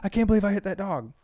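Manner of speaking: imitating a friend in shock; freaking out. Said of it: "He's like, freaking out".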